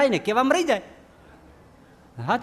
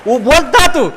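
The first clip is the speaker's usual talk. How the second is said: much higher, much louder roar of a god; roaring Hanuman; 11 semitones higher, 7 dB louder than usual. Acted. Hanuman roars at the friend to drink it: furious bellow. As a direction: bellow the threat like an angry Hanuman. Hanuman's angry order retold.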